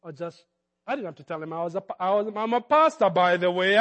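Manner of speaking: usual speaking voice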